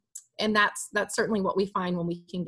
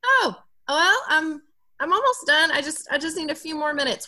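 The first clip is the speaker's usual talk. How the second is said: put-on higher, louder excuse-making kid; stalling kid; 6 semitones higher, 4 dB louder than usual. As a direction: play the child fumbling for an excuse. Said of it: "he then is gonna have to say something like".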